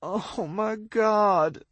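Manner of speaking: high, slow, tearful cry; injured girl crying up from the ledge; tearful shout. A narrator's reading. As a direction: wail it out in pain. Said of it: "she shouts tearfully".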